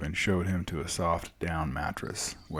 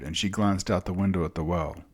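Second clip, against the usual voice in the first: usual speaking voice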